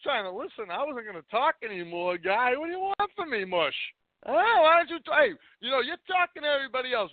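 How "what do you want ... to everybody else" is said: heated protest. Real, not acted. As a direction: argue back, worked up. Caller argues back, heated.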